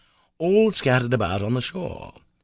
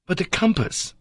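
cry; 10 semitones higher than usual. A narrator's reading. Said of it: "I cried".

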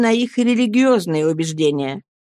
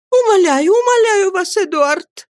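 high, loud, urgent entreaty; desperate begging outcry; pleading cry; 11 semitones higher, 4 dB louder than usual. A narrator's reading. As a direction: cry out imploringly in a much higher woman's voice. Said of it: "закричала матушка".

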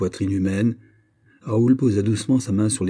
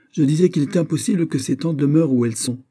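raised pitch, tempered anger; colère contenue; 6 semitones higher, 4 dB louder than usual. A narrator's reading. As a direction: insist angrily, but keep it in check. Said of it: "avec un accent de colère encore sensible". (colère retenue, ton plus aigu)

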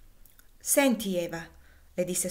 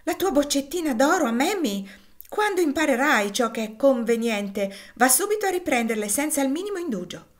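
raised, indignant mother's telling-off; exasperated, higher and louder; impatient scolding; 6 semitones higher, 4 dB louder than usual. A narrator's reading. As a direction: scold the child with rising impatience. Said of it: "Gridò Maria battendo i piedi con impazienza".

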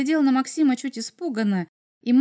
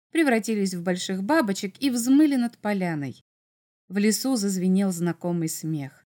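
ordinary delivery

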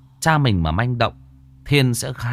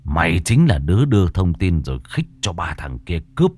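seethe the accusation close to her face; menacing low threat; 5 semitones lower than usual. A narrator's reading. Thiên grips Lài's hair and accuses her of the killing, lower and seething.

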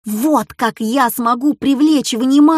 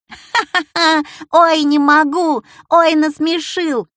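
laughing; 7 semitones higher, 3 dB louder than usual. Acted. Brother laughing at dad's answer.

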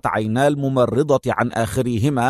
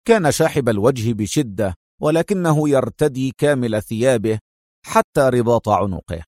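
ordinary talk, nothing marked

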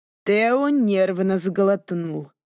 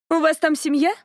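put-on curious young lady; 7 semitones higher than usual. A narrator's reading. Narrator voices Cassandra.

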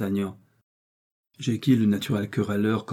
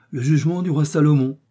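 higher, louder amused cry; exclaiming; 6 semitones higher, 6 dB louder than usual. A narrator's reading. Guards praising Aramis's wisdom, delighted exclamation. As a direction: call it out with delight. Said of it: "s'écrièrent les deux autres gardes".

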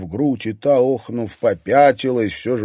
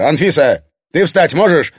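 loud, raised, urgent question; angry call; 6 semitones higher, 7 dB louder than usual. A narrator's reading. Egor checking whether his wife can stand, angry call. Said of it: "зло крикнул Егор".

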